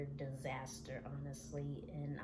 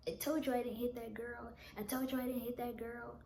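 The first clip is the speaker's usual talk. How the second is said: imitated higher-pitched protest; insistent denial; 6 semitones higher, faster than usual. Boyfriend insists on his denial.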